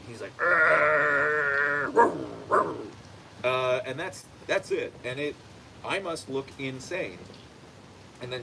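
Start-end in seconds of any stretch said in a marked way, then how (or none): 0.4-2.8 long drawn-out growl into short woofs; imitated dog growl. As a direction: growl like a jealous dog hiding behind legs. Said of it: "he's just growling".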